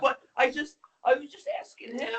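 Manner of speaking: high and baffled; confused high voice. Retold or imitated. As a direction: play it lost and confused. Gabe's confused reply quoted.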